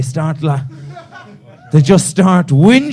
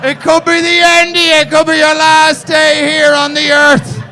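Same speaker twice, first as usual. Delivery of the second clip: high, loud, hammed-up carpe diem rallying cry; mock pep talk; 10 semitones higher, 6 dB louder than usual. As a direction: pump him up like a fake life coach.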